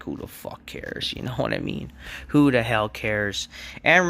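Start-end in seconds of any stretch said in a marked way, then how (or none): 0.0-1.1 dismissive. Real, not acted. Dismissive rant aside.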